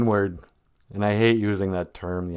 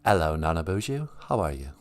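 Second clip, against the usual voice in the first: lower, soft British rock star caller; 5 semitones lower, 6 dB quieter, faster than usual. Acted. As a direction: answer as a softly spoken English celebrity.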